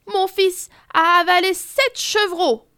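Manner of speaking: a tearful mother trying her luck; sobbing she-wolf. Acted. She-wolf in tears pleading to the jury, mildly sobbing. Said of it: "tente une louve en sanglots".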